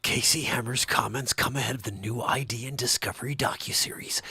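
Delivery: breathy, weighty trailer voiceover; movie premiere voice. Pretend. Host mock-announces a docuseries premiere, trailer voice. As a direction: sell it like a dramatic film trailer promo. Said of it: "now the movie premiere".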